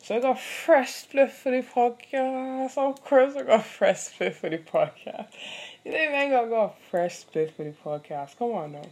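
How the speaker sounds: loud, drawn-out hyped-up brag; playful boasting. Pretend. Podcaster bragging about a fresh spliff, loud playful boast. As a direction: crow it slowly and loudly.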